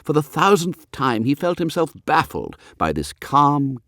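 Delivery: plain reading voice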